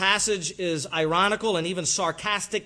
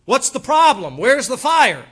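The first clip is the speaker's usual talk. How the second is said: louder taunting questions; mock taunt; 7 semitones higher, 11 dB louder than usual. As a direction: needle them like Elijah. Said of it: "Elijah mocked".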